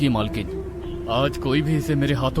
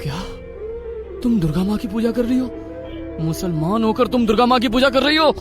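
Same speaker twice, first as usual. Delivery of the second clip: outraged husband; 4 semitones higher, faster than usual. A narrator's reading. Husband catches his wife at forbidden worship, outraged. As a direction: confront her, voice rising in outrage.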